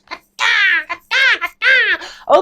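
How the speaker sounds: very high screechy caws; bird call. Acted. Student carrying on as a bird, high caws. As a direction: keep squawking like a bird. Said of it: "I'm gonna continue being a bird".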